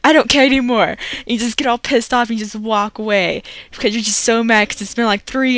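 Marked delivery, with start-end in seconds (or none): 0.0-0.9 higher and louder, fed-up outburst; imitating a gamer losing it, moderately loud; flipping out. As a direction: snap and give up on the game. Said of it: "like, you flip out".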